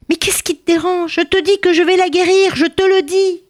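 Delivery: high, begging cobbler insisting; pleading. Acted. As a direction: plead up high, desperate to get in. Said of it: "Le cordonnier supplia".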